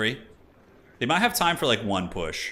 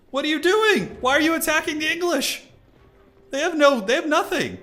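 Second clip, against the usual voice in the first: much higher, disbelieving cry at the screen; shocked outburst; 5 dB louder than usual. Real, not acted. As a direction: shout at the player in sheer disbelief. Caster shocked by an attack.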